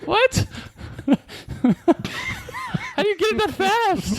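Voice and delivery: high, laughing disbelief. Real, not acted. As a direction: squeal it through laughter. Amazed at quick answer.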